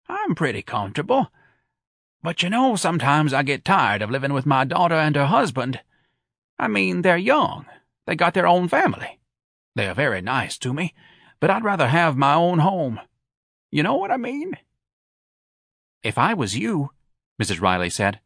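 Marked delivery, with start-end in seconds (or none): 0.1-14.6 put-on higher-pitched, confiding older man; Mr. Robichaux character. A narrator's reading. Lonely father confiding about living with his daughter, higher character voice. 16.0-16.9 higher, advising mother figure; Mrs. Riley character. A narrator's reading. Mother advising her friend, higher character voice.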